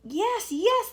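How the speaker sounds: high, loud eager agreement; eager chorus. Acted. Creatures eagerly agreeing to go upstairs. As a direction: jump at the chance. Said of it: "they chorused".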